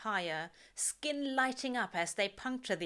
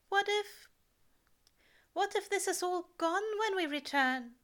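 higher, anxious angel voice; fearful question, pitched up; Aziraphale worried; 11 semitones higher than usual. A narrator's reading. Angel fearing Earth will be gone, anxious and higher.

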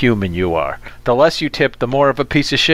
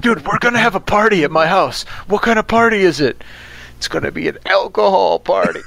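higher-pitched, hammed-up young teen; mock thirteen-year-old; 6 semitones higher than usual. Host teasing friend over "alcohol party", as a mock thirteen-year-old. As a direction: play an excited kid planning a party. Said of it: "it's not like you're thirteen".

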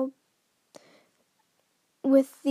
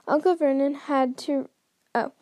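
ordinary delivery, nothing marked

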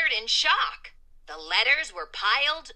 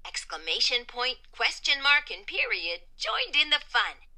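ordinary delivery

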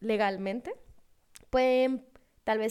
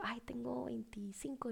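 hushed, unvoiced confession; retold whisper; 12 dB quieter than usual. Traveler confiding her age to another hopeful, retold whisper.

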